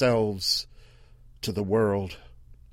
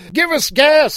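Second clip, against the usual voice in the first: very high, loud desperate yell; 12 dB louder than usual. Acted. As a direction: beg at full volume. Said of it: "someone inside yelled".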